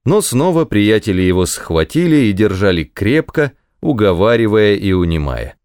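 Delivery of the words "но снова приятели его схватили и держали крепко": usual speaking voice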